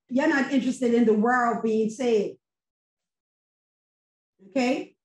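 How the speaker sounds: ordinary talk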